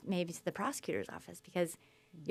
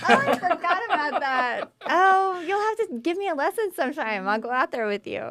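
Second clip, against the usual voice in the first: laughing, much higher and louder; excited laughing; 11 semitones higher, 9 dB louder than usual. Real, not acted. Jen delighted to learn her host surfed, laughing high and loud.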